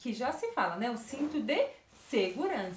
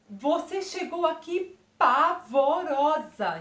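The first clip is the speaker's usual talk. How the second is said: "pavorosa" drawn out loud, syllable by syllable; palavra por sílabas; 4 semitones higher, 6 dB louder, slower than usual. Acted. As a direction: act startled and break "pavorosa" into slow, loud beats. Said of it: "Ela falou essa palavra, tipo assim". ("pavorosa" dita alto, devagar, sílaba por sílaba)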